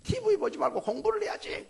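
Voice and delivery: put-on high, loud nag at a child; a mother ordering her kid off the TV; mock-stern parental nag; nagging parent.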